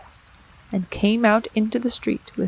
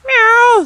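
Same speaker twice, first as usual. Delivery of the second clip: loud cat meow; 11 dB louder than usual. A narrator's reading. Cat mews.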